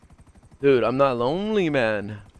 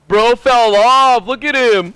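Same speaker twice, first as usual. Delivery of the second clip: slow, loud, higher taunting voice; mocking taunt; 7 semitones higher, 7 dB louder, slower than usual. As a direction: drag it out to taunt.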